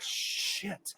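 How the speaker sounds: high, drawn-out squeal of dismay; mock dismay. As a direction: play Ronaldo discovering the damage. Ronaldo looks down at lost pack.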